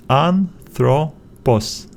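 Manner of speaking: "Anthropos" slowly split as an, thro, pos; syllable by syllable. Shown as a demonstration. Teacher splitting the word after the vowel, slow and separated. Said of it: "we need to split it after the vowel".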